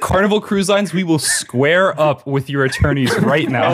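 loud, high mock shout; mock challenge. Host picks a fight with Carnival, loud mock shout. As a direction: bluster at the cruise line.

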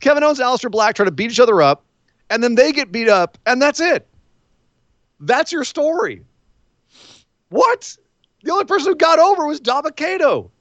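high-pitched incredulous rant; exasperated disbelief. Real, not acted. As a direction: voice climbing into a squeal of disbelief. Exasperated rant at absurd wrestling segment.

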